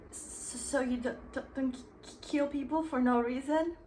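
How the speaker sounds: hammed-up stammer with the start nearly silent; nervous stammering reader voice; mock stutter. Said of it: "You couldn't stop stuttering".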